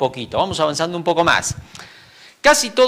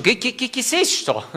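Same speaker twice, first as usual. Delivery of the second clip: startled; 8 semitones higher, 4 dB quieter than usual. Pretend. Imagined startled reaction to angels.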